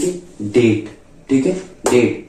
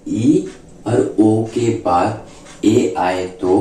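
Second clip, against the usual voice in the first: slow teaching rule; 4 semitones lower, much slower than usual. Shown as a demonstration. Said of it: "क्या बोले".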